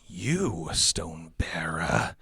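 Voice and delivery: slow, breathy, ominous address; transformed villain. Acted. Skull-faced villain addressing the hero, slow breathy menace. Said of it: "The voice now fully changes".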